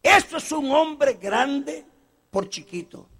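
usual speaking voice

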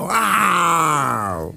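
long low drawn-out roar; hammed-up lion roar; slow lion roar. Lion roars.

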